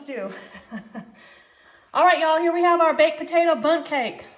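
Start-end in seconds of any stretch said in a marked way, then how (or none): none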